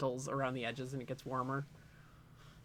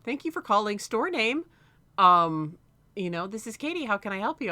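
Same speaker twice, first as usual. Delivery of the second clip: higher, polite phone greeting; customer service voice; 5 semitones higher, 5 dB louder than usual. Retold or imitated. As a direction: switch on the sweet shop voice on a dime. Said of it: "And you were like".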